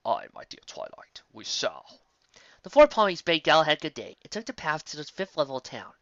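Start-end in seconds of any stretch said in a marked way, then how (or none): 0.1-2.0 put-on low, hushed elderly voice; old wizard. Star Swirl agreeing to lunch with Twilight. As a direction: say it like a kindly old mentor.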